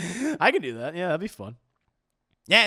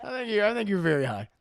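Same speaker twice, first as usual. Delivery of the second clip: laughing; 7 semitones higher, 6 dB louder than usual. Real, not acted. Teasing friend about being high.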